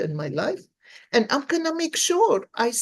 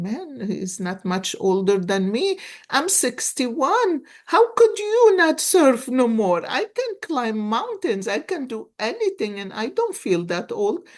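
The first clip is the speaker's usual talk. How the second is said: indignant disbelief; 6 semitones higher, 4 dB louder than usual. Retold or imitated. Retold own indignant thought.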